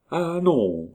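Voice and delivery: high-pitched start of remembering; as if it just came back to you; sudden recollection. A narrator's reading. False Nita pretending a memory struck her, sudden recollection. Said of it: "comme si un souvenir subit l'eût frappée".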